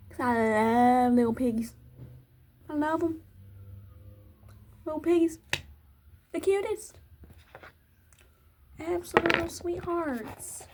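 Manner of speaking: high, cutesy cooing over piglets; gushing adoration. Real, not acted. Gushes over little pigs.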